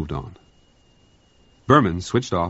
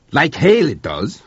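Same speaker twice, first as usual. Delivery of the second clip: retort; 8 semitones higher, 9 dB louder, slower than usual. Acted. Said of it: "Blanchard retorted".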